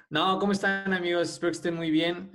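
podcast host intro. Acted. Restarting podcast intro greeting.

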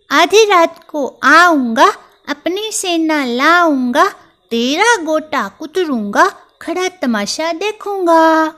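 high, loud mouse threat, rising at each line end; a furious little mouse vowing revenge; angry threat. Acted. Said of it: "Chunky को फिर गुस्सा आ गया".

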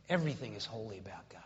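near-whispered, low and quick repeat; very soft, low emphasis; hushed. Real, not acted. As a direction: drop to a reverent whisper. Repeats the point in hushed reverence.

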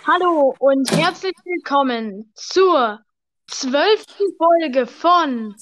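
slow drawn-out show intro; announcer intro. Acted. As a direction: stretch it out like a show host.

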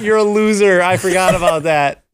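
high-pitched exclaiming protest; playful indignation, pitch way up; mock outrage. Real, not acted. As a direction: cry out in exasperated disbelief. Protesting friend's contrary opinion.

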